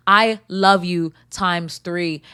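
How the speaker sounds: very slow, drawn out; slow sincere love. Real, not acted. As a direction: stretch each word tenderly. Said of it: "get that out three times".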